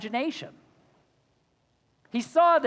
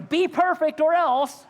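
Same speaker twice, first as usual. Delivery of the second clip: parent's threatening ultimatum; stern parent; 8 semitones higher than usual. Retold or imitated. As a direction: bark it like a fed-up parent laying down the law. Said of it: "some parent going".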